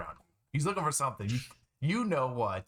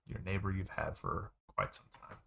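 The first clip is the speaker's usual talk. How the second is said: ordinary talk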